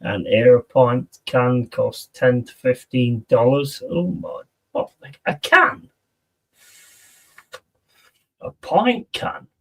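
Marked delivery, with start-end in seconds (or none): 3.9-9.5 high-pitched disbelieving question; stunned, much higher than usual; incredulous. Real, not acted. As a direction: query it in disbelief, pitch leaping up. Disbelief at the price of a can.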